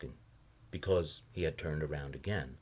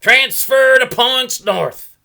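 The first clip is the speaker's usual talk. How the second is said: bus driver bellowing much higher and louder; bellowed; 21 dB louder, much slower than usual. A narrator's reading. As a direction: call the bus out over the engine. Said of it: "the bus driver bellowed".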